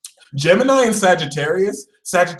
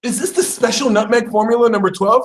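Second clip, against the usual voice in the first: high, excited question; high-pitched wonder; amazed taster; 8 semitones higher than usual. Acted. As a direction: ask it as if overcome by the flavor.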